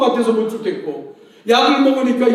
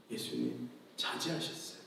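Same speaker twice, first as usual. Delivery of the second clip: hushed, unvoiced whisper; 11 semitones lower, 22 dB quieter than usual. Real, not acted. Preacher whispering to the congregation about restraint. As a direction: drop to a whisper to make the point.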